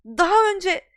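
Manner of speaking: high, loud appalled voice; outraged professor. Acted. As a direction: scold in disbelief.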